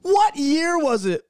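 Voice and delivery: very high, loud, slowed what-year question; real disbelief. Real, not acted. Reacting to blonde streaks story.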